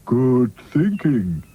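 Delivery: slow, contented Sad Sack voice; Sad Sack musing. Acted. Sad Sack enjoying the refreshments, slow musing. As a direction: mull it over slowly, mouth full. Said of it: "agreed Sad Sack".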